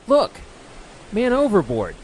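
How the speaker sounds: high, loud lookout's call; alarmed shout. A narrator's reading. Sailor alerts the crew to a man overboard, shouting. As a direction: shout it across the deck.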